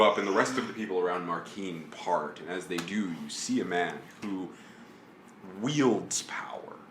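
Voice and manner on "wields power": normal talking voice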